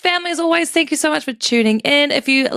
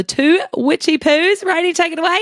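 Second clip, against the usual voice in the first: shouting; 14 semitones higher than usual. Real, not acted. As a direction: yell it out, then crack up laughing. Shouted sign-off ending in laugh.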